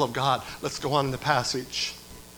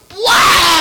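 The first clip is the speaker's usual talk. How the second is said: higher, louder crying "Wow!"; a shouting player at full stretch; exultant shout; 14 dB louder than usual. Retold or imitated. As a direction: yell it like a player flying into the crowd. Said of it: "and he goes".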